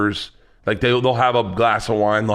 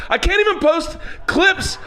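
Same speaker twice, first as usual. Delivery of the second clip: high, loud laughing yell; howling the words out laughing; shouting while laughing; 4 dB louder than usual. Real, not acted. Laughing shout about podcast clips.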